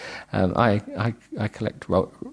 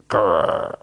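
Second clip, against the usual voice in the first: playful growl; 3 dB louder than usual. Shown as a demonstration. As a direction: growl it like a grumpy dog. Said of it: "I love a growl".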